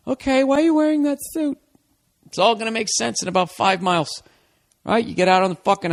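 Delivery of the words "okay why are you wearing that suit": put-on high, puzzled girlfriend voice. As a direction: play the confused girlfriend way up high. Girlfriend questioning in the car.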